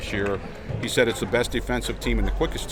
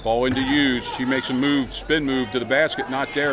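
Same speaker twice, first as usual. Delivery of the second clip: fast sports commentary; 3 semitones higher than usual. Acted. Live play-by-play of a game.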